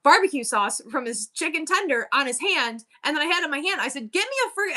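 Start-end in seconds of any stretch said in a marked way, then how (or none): 4.1-4.8 retold yell. Stressed mom to her son, mock yell. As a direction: imitate snapping, pitch jumping up. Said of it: "I, like, yelled at him".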